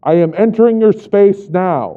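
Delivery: loud, formal cop announcement; announcing entry. Acted. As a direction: announce yourself like police at a door. Cop father announces entry through door.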